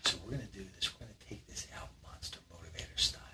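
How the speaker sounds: fast, breathy build-up; hyped whisper. Acted. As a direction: whisper the big reveal. Host hypes the challenge in a whisper.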